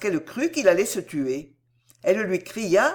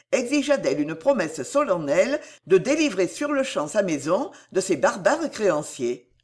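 usual speaking voice

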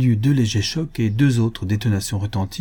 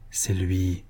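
soft, lower, seething murmur; angry murmur; 3 semitones lower, 6 dB quieter than usual. A narrator's reading. Woman alone realizes who fired, murmuring in anger. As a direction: mutter it under the breath in anger. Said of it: "murmura-t-elle avec colère".

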